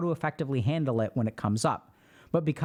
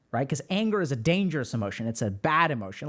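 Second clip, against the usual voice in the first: ordinary talk, nothing marked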